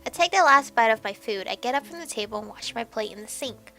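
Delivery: ordinary talk, nothing marked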